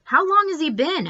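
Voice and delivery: put-on high, bright child asking; little sister voice. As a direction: play the impatient kid sister.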